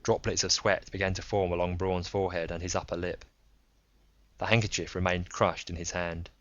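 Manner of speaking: usual speaking voice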